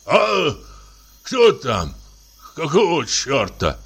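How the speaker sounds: high startled waking cry; alarmed shout. A narrator's reading. Samoylenko, startled from sleep, cries out at the window. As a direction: jolt awake and call out. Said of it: "тревожный окрик".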